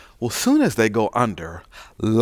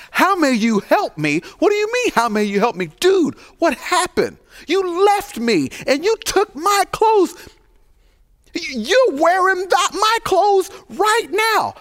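outraged. Acted. Truth confronts Lies at door.

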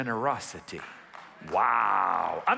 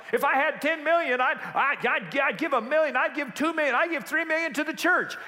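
higher, hammed-up eager pledge; mock grand promise; 12 semitones higher, 3 dB louder than usual. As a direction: pile up the millions, mocking. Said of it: "You say".

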